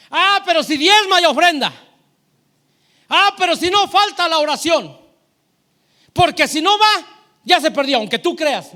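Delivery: high, very loud preacher mocking objections; impassioned, sarcastic pulpit shouting; full-force preaching. Real, not acted. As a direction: belt out each imagined condition to the congregation. Preaches, mocking imagined conditions.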